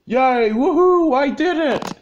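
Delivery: high, loud triumphant whoops; happy cheer. Acted. Super Tyler cheering after pushing the giant fufa. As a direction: cheer at the win.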